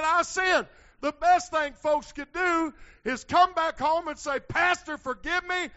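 loud, high, driving pulpit shout; full-force preaching. Real, not acted. Preacher urging folks who left to come back home, shouted preaching. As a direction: preach it hard, stretching the key phrases.

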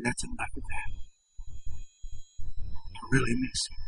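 near-whisper, barely getting the words out; very soft, as if overcome with grief; choked up. A narrator's reading. Preacher quoting Mister Templeton composing himself, choked near-whisper.

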